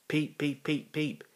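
high sung peep peep like a train whistle. A narrator's reading. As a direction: toot it out like a little engine's whistle. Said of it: "whistled Thomas".

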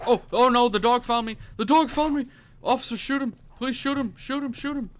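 high, loud frightened cries; panicked pleading. Real, not acted. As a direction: scream for help in real fright. Dog found him, begs officer.

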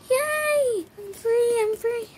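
high, loud mock cheer; pretend joyful relief. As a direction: squeal with play triumph. Relief after spider gone.

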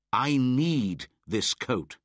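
put-on stubborn boy holding his ground; firm and insistent. A narrator's reading. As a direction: plant his feet and refuse. Mowgli refuses the hunter.

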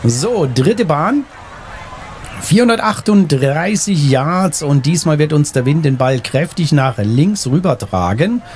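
ordinary delivery

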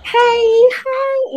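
put-on high, bright-eyed hello for children; kid-class greeting. Teacher showing how she greets kids: excited. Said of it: "For the kids, it's like".

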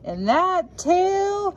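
excited climax. A narrator's reading. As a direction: stretch it out with rising thrill. Climax of the story.

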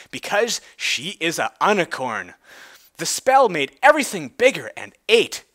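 usual speaking voice

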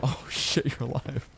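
ordinary delivery

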